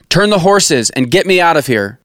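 high-pitched, urgent plea; mildly acted groan of a wounded king. A narrator's reading. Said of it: "Ahab groaned to the driver".

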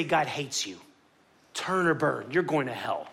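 low, understated voice of condemnation; condemning sign slogans. Retold or imitated. Voices hostile campus protest signs.

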